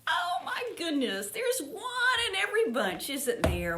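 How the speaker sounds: amused and tickled by the odd duck; laughing delight. Real, not acted.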